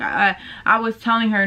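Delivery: normal talking voice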